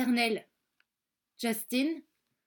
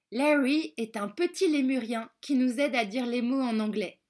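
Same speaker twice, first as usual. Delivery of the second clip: usual speaking voice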